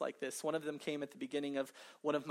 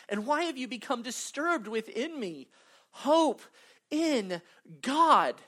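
louder, building to a cry of hope; impassioned reading; 5 semitones higher, 7 dB louder than usual. Acted. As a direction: preach the verse with rising fire. Reads psalm with rising passion.

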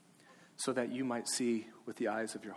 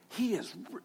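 higher, start it with energy then break off; cut-off exuberant start; 7 semitones higher than usual. Shown as a demonstration. Pastor showing kids how to belt it, cut short. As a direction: begin to let it go. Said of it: "you have to let it go".

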